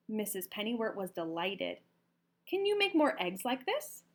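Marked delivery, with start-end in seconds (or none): 2.5-4.0 high, eager asking; higher, eager farm lady; eager question. A narrator's reading. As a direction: lean in with excitement. Pennywort asks eagerly.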